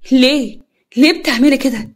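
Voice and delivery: anguished demand. Acted. Daughter confronts mother over fiancé.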